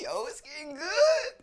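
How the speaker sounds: very high, drawn-out squeal; giddy delight. Real, not acted. Host hooked on courtroom drama, giddy squeal. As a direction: relish it in a slow falsetto. Delighted reaction to judge's clip.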